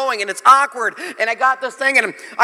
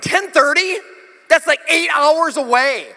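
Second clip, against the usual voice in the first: high, stretched-out disbelief; mock-appalled reaction, drawn out; incredulous outburst; 8 semitones higher, 4 dB louder, slower than usual. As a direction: exclaim in dismay, pitch leaping up. Reacts to long delay.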